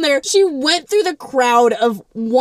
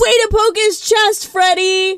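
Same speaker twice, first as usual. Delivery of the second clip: cheering yell; 8 semitones higher than usual. Retold or imitated. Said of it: "Carly yells".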